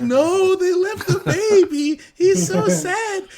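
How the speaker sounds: put-on high, slow, teary little-girl wail; imitating a sobbing toddler. Dad retells his daughter crying over the baby reindeer, in a high teary toddler voice. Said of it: "daughter started fucking sobbing".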